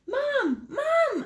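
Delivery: put-on a boy yelling for his mom across the house; excited shout. Andres calling his mom after solving the cube, thrilled shout. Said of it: "Andres shouted".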